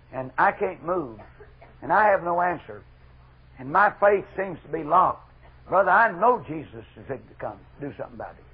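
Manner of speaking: normal talking voice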